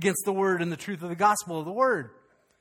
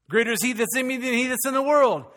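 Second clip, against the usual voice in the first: raised voice, but without full punch; lacking potency; 6 semitones higher, 4 dB louder than usual. Shown as a demonstration. Preacher tests how the congregation takes a familiar verse, less forceful delivery. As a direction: recite it high, holding some force back. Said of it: "Didn't even have any potency right there".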